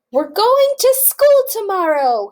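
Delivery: high, loud excited call; announcing. Acted. Farmer announcing school to the pigs, loud and excited. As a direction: proclaim the big news. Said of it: "he announced".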